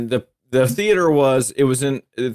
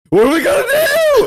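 squeaky high panicked wail; voice shooting way up; 6 dB louder than usual. Retold or imitated. Retelling Delmar's panicked line from the movie, squeaky high wail. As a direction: crack your voice up high like a frightened fool. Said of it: "his voice goes up like that".

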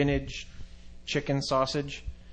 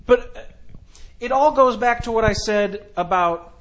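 excited; 7 semitones higher, 4 dB louder than usual. Real, not acted. Excited high pitch pitch.

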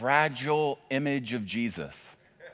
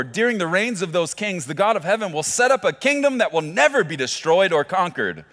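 fervent scripture reading; 8 semitones higher, 9 dB louder than usual. Acted. Preacher reading Daniel to the church, loud and fervent. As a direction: read the verse like a proclamation.

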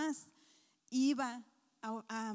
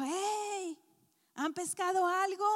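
calling out; 7 semitones higher, 5 dB louder, slower than usual. Acted. Jesus calling out to boat.

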